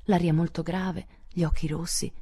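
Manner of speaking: plain reading voice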